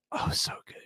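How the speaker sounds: breathy unvoiced whisper of delight; whispered savoring. Real, not acted. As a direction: whisper it like tasting something delicious.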